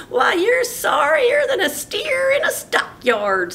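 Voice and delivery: very high, loud, slow sneering jeer; mock Bubba cruelly; mocking brother. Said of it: "said Dwayne".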